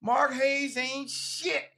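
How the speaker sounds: high, drawn-out mock yell without much volume; stretched-out, high-pitched imitation of a rant; retold hollering. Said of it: "you screaming at the top of your lungs".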